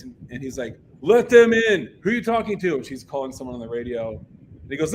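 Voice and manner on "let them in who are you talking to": loud, high retold demand; mock angry yell; Fletcher. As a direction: imitate an impatient man shouting down a gatekeeper. Fletcher yells at gatekeeper.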